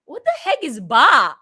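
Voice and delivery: loud, slow, baffled question; mock bafflement. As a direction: puzzle over it loudly. Retold confusion at new word.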